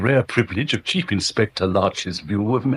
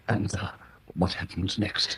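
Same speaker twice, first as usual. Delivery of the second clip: hushed whisper; 3 semitones lower, 9 dB quieter than usual. Acted. Discreet question about scandal.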